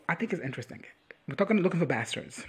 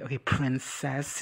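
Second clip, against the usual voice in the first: "princess" drawn out slowly in a mock quote; mocking princess jibe; slower than usual. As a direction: stretch out "princess" as a teasing nickname. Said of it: "he basically called him a prince".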